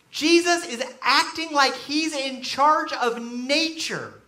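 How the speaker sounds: usual speaking voice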